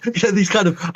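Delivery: usual speaking voice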